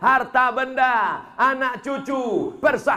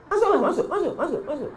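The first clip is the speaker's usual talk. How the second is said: high mock dare fading into a laugh; teasing dare; 7 semitones higher, faster than usual. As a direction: egg her on jokingly, then dissolve in laughter. Teasing widow to jump in.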